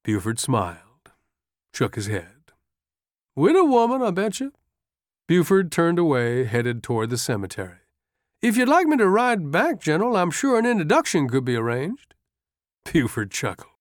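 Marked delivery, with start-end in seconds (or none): 3.3-4.5 high, grinning aide's voice; teasing wheedle from a sergeant; coaxing sergeant. A narrator's reading. Sergeant keeps coaxing the general. 8.4-12.1 high, lilting aide's voice; grinning subordinate making an offer; coaxing sergeant. A narrator's reading.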